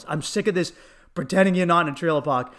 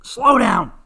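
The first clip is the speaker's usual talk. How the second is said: loud, high imitated scream; mock bystander's yell; 4 semitones higher, 8 dB louder than usual. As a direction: holler it down the street. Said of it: "the person who screams".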